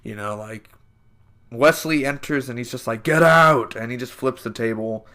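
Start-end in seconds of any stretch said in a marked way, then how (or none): 3.0-3.8 sharp shout of get out; retold yell. Wesley bursting in, shouting get out. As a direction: bark it at the room.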